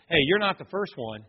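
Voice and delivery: usual speaking voice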